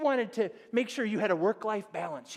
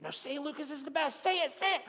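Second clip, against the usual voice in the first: higher, teasing bully voice; big brother twisting an arm, taunting higher; mock sibling taunting; 6 semitones higher than usual. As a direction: gloat over your pinned little brother. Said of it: "until he said".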